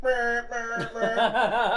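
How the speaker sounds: loud, high beeping honk; car alarm imitation. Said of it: "and I'm like".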